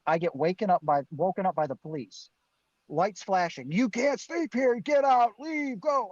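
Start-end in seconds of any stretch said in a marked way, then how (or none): 3.7-6.1 barking cop. Retold or imitated. Police officer waking a sleeper at a truck stop, barking orders.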